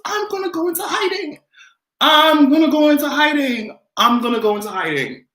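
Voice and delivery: mock despair. Real, not acted.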